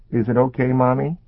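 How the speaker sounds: put-on high, anxious child's question; worried little girl. A narrator's reading. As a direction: ask like a frightened little girl. Child character voice.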